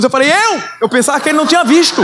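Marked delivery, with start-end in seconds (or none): 0.3-0.6 high excited cry of "Eu!"; eager shout. Retold or imitated. Young man answering the pastor's altar call, high and eager. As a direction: jump in eagerly.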